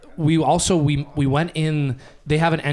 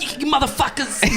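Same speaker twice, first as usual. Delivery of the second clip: put-on high, squawked Southie accent; mock Southie accent; 10 semitones higher than usual. Girlfriend starts beefing with Leafs fans, mock Southie accent. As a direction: imitate a Southie girlfriend cursing. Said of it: "real Southie on us".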